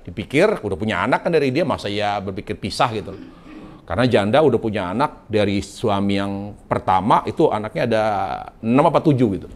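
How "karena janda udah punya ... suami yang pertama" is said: ordinary talk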